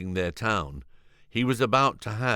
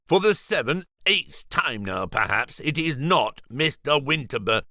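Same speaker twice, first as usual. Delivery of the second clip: exasperated; 6 semitones higher than usual. Acted. George exasperated repeating.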